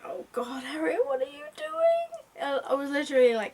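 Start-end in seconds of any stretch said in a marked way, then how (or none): 0.1-0.6 breathy hushed oh God, under the breath; whispered dismay. Retold or imitated. Reader whispering in dread at Harriet. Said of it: "I'm like". 0.6-2.4 high anguished wail at a character; exasperated cry. Retold or imitated. Reader wailing at Harriet's mistake, high and despairing. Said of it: "I'm like".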